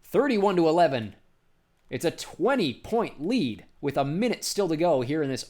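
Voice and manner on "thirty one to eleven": loud, higher score announcement; excited announcer proclaiming the score; emphatic score call. Real, not acted. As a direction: punch out the new score. Commentator announces score after big basket.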